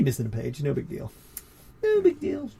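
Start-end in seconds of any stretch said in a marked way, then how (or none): 1.8-2.5 much higher, falsetto echo; squeaky put-on voice. Co-host brushing off the missing page, in squeaky falsetto. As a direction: repeat it in a tiny high mock voice. Repeats own words in put-on voice.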